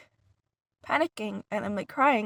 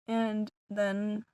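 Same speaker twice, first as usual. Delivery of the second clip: ordinary delivery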